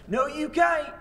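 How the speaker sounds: high-pitched Southern contradiction; indignant Southern accent. Retold or imitated. Southern man contradicting the teacher, mock-indignant high Southern accent. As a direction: imitate a Southerner stubbornly arguing back. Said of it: "in this particular accent".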